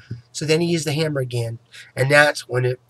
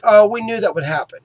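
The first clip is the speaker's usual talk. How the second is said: mocking the dentist; 5 semitones higher, 7 dB louder than usual. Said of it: "Then after it broke".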